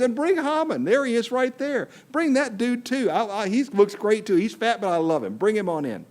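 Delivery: a queen luring the villain, high and gushing; sweet-talking queen. Acted. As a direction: coo the invitation, sugary and bright. Esther inviting Haman sweetly.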